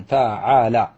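فعل drawn out as fa-'a-la; each syllable of فعل held apart. Shown as a demonstration. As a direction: sound out فعل slowly to show the pattern. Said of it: "على وزن".

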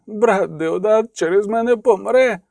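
high, slow tearful lament; sobbing. A narrator's reading. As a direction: wail it through tears. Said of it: "схлипнув він".

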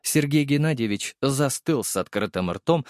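ordinary delivery

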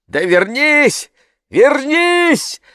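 high, drawn-out call; shouting from shore. A narrator's reading. People on shore call the boat back, shouting. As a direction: holler to someone out on water. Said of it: "закричали с берега".

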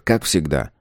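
usual speaking voice

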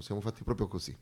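ordinary delivery

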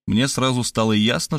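ordinary delivery, nothing marked

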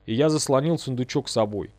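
usual speaking voice

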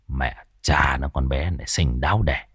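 put-on a young man's low grumbling curse under his breath; low muttered curse. Tuất curses the girl under his breath, low mutter.

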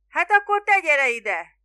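high, loud, insistent shout; yelling. A narrator's reading. Spiro ordering the boatman over, yelling. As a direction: yell the order across the bay. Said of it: "kiabálta Spiro".

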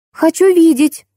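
put-on high-pitched toddler's demand; insistent small child. A narrator's reading. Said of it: "тут же заявила малышка". (детским голоском, требовательно)

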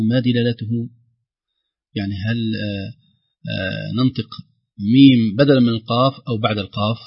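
normal talking voice

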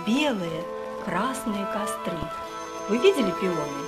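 normal talking voice

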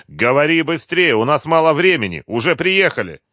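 loud, high, impatient push; urgent demand. A narrator's reading. Colonel prods captive as they arrive, urgent. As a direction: hurry him into answering.

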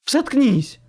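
loud, high female snap; sharp angry shout from a woman; barked order. A narrator's reading. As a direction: bark it to silence him. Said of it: "рявкнул женский голос".

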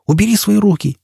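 angry outburst without much added volume; furious cry. Acted. Jisbella tells Foyle to let go, furious cry. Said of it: "яростно закричала она".

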